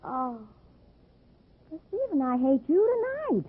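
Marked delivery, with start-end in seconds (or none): 1.7-3.4 pouty spite. Acted. Pouting at captor, gun drawn.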